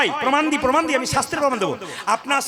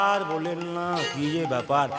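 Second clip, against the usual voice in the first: grumbling husband; 9 semitones lower, 6 dB quieter than usual. Retold or imitated. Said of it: "দুই চারজন দাদা ভাই বলছে".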